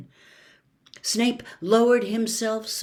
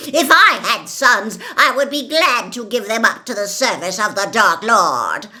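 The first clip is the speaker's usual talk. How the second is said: louder, proud and cold; Bellatrix, fanatical; 2 semitones higher, 8 dB louder than usual. A narrator's reading. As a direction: boast of sacrifice. Bellatrix continues.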